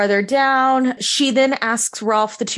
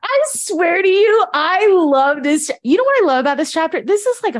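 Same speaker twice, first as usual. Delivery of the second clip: loud, higher, delighted burst of love for the chapter; can't contain the joy, louder and higher; gushing excitement; 8 semitones higher, 3 dB louder than usual. Real, not acted. Podcast host to co-host about her favourite chapter, excited gushing.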